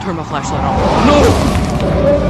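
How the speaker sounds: panicked yell, rising to a scream; yelling while running away. Real, not acted. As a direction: shout it as the monster catches you. Chased by monster in game.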